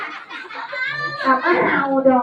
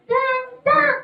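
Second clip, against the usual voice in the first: high-pitched puppet repeat; puppet character; 13 semitones higher than usual. Acted. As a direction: parrot it back high.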